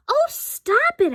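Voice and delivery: very high, slow exasperated plea; exasperated girl. A narrator's reading. Girl begging her friend to stop quacking. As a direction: drag it out, fed up. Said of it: "I said".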